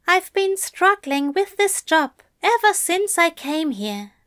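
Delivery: Aziraphale voice. A narrator's reading. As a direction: lift the voice for the fussy angel. Aziraphale's lines.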